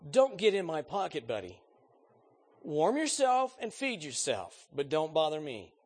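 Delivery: loud, high, cruel sarcastic brush-off. Shown as a demonstration. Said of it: "The middle voice would probably be caustic or sarcastic".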